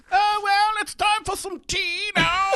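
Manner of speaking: put-on high, slow posh British accent; a prim British gentleman taking tea, in a British accent. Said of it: "Why is your British guy showing up?".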